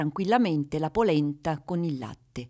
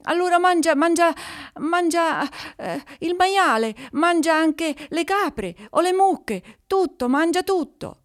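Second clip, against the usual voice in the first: high, fast, frantic pleading; a terrified man offering everything; desperate; 9 semitones higher than usual. A narrator's reading. Shepherd frantically offering his animals to the monster, high desperate voice. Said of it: "il pastore disperato".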